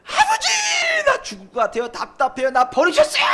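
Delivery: a shouted, pleading prayer of despair; desperate crying out. Retold or imitated. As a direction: cry out to God, high and loud, at the end of your rope. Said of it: "악을 써야 되는 거예요".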